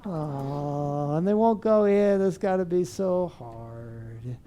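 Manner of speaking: put-on hushed, drawn-out sympathy for a crying kid; soothing voice. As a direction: soothe a hurting child with your voice. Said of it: "a soothing voice is, is a beautiful thing".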